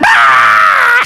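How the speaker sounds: loud, low mock wail; sudden loud bawling cry, acted out; imitated wail. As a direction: burst out in an imitation scream. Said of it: "starts wailing and screaming".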